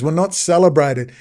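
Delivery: ordinary delivery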